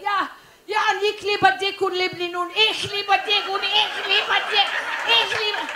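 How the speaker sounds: loud, high mock-passionate German; excited German boyfriend. German boyfriend in the height of passion, hammed-up excitement in German. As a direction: imitate a frantic German lover building to a peak. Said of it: "he's really getting excited".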